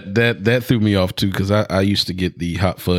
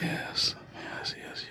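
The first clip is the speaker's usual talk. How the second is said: breathy unvoiced yeses; whispering; 16 dB quieter, much slower than usual. Real, not acted. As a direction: whisper each yes softly. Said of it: "He was whispering".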